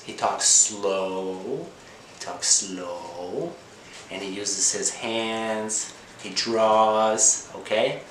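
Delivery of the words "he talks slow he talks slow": drawn-out "slow", vowel stretched long with a rise. Shown as a demonstration. Language teacher showing learners how slowly the man talks, slow.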